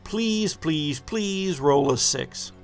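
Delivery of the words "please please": ordinary talk, nothing marked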